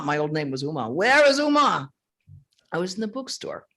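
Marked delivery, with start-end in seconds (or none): 0.9-1.9 raised, higher searching call. Retold or imitated. The man hunting for Uma, imitated calling out. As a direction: call out the question as the searcher did.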